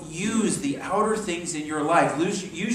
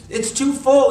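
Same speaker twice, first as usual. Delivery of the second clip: higher, louder mock alarm; alarmed shout; 10 semitones higher, 10 dB louder, slower than usual. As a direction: cry out as tea spills over. Seeker exclaims at overflowing tea.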